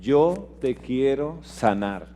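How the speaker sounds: "Yo te quiero sanar" said very slowly and gently; tender promise. Acted. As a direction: speak God's promise softly, stretching it out. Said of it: "Dios dice".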